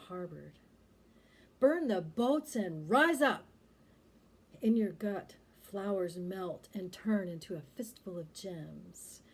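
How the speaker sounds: slow, ringing poem delivery, swelling on key words; poetry recitation. Acted. Poet reads her tribute to [name], impassioned poetry recitation. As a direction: declaim it with long pauses and big swoops.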